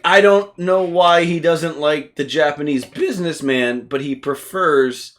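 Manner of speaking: slow deliberate musing. Acted. Acting out puzzlement over Godzilla's tastes, slow quiet musing. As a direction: draw it out softly, with pauses.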